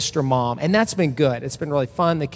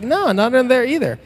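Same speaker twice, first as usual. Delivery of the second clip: put-on higher, louder kid voice; son, now unafraid, shouting higher that nothing is under the bed; brave little boy; 9 semitones higher, 5 dB louder than usual. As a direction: play the child proud and brave.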